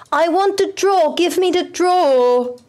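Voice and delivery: high, drawn-out whining; whiny demand. Real, not acted. Impatiently demanding the draw.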